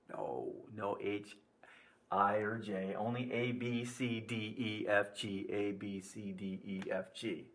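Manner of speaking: low, soft recital of letters; subdued correction. Real, not acted. Teacher correcting his own trick on students, quietly. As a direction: drop low and soft, listing the letters evenly.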